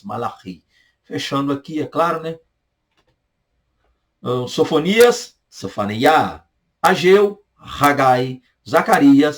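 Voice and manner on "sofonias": ordinary talk, nothing marked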